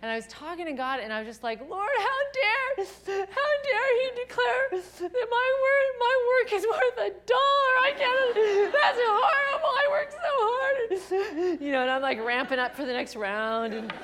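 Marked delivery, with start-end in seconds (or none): 1.6-11.6 much higher, louder, slow crying protest; sobbing to God. Retold or imitated. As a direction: wail it to God through tears, outraged and hurt. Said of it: "I was sobbing my guts out and crying out to God".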